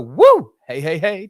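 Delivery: loud whooping cheer. Real, not acted. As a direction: whoop for the guest. Cheering the guest.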